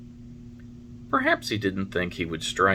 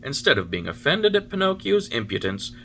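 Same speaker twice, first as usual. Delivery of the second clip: ordinary delivery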